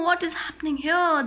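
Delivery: ordinary delivery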